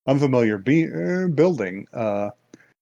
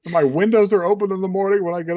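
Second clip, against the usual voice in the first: pleading schoolgirl; 7 semitones higher than usual. Retold or imitated. Girl pleading in retold story.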